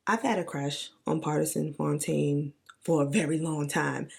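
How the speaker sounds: usual speaking voice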